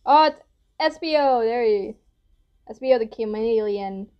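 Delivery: normal talking voice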